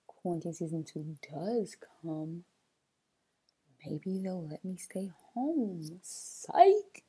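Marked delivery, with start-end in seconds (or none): 3.8-6.0 hushed wishful whisper. Real, not acted. Hoping aloud for time off. 6.0-7.0 sudden high, loud "Psych!"; spring the joke on them; teasing psych. Real, not acted. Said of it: "I'm just playing".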